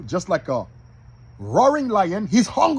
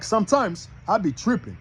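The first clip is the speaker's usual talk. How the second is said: ordinary talk, nothing marked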